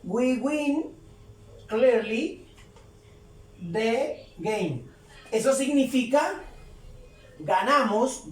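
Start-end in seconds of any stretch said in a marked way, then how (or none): 0.0-4.8 spaced-out dictation for learners; word-by-word model. Shown as a demonstration. Teacher reading an English example to learners, word by word. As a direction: say it word by word, pausing between.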